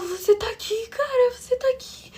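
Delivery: voz bem aguda, embargada de choro; reencontro choroso. Retold or imitated. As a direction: reviva o alívio quase chorando. Reencontra Lincoln vivo, em prantos. (espanto choroso ao reencontrar alguém)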